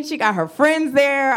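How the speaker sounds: ordinary delivery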